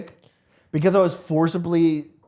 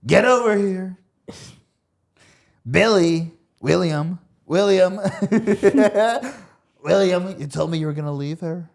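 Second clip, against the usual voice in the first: loud, high, demanding call across the room; Trump impression; 5 semitones higher, 5 dB louder than usual. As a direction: bellow for William like you are owed. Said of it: "Did Trump always talk like that?".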